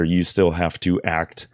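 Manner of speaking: normal talking voice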